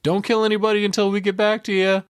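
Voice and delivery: put-on high, hurried kid's warning; imitating Sam. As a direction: blurt it out like a panicked little brother. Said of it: "Sam runs over like".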